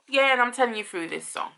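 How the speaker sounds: normal talking voice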